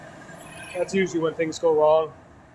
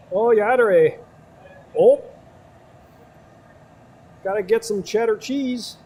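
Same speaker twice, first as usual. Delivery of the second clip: put-on Wisconsin accent; 5 semitones higher than usual. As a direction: play the cheese-and-kraut Wisconsin local. Hamming up Wisconsin stereotypes.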